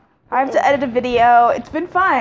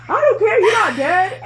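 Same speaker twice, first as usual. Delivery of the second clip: dismissive mom; 9 semitones higher, 4 dB louder than usual. Retold or imitated. Voicing mom while beating.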